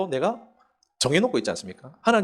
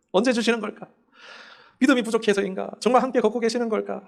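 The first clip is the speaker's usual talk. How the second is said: doubting believer; 6 semitones higher than usual. Acted. Voicing doubting questions.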